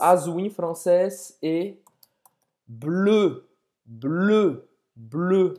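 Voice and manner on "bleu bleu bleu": "bleu" with rounded lips and an e sound; teaching the French eu. Shown as a demonstration. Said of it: "faz a boca do O e fala E". ("bleu" com bico de O e som de E)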